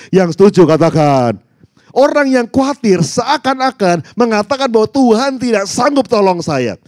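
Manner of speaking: loud, high, hammering sermon delivery; full-force preaching. Real, not acted. Preacher rousing the congregation to trust God, full-force preaching. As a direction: preach it out with rising force, punching the key words.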